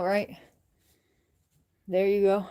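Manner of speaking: usual speaking voice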